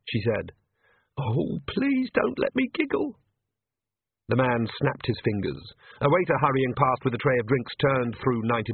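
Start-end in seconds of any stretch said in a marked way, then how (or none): 1.2-3.2 very high, slow pleading; desperate inner plea. A narrator's reading. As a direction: squeal it like a silent prayer. Inner plea not to giggle.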